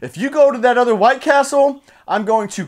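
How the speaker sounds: snapped threat. Retold or imitated. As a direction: imitate a friend blowing up. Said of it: "he snapped and he said".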